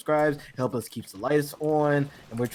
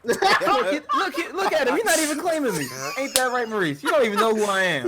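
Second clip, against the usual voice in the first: high, laughing mock protest; laughing mock complaint; 11 semitones higher, 4 dB louder than usual. Teasing his co-host, high and laughing. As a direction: tease Maurice while laughing. Mock hurt at being unclaimed.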